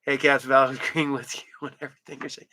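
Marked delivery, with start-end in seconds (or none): none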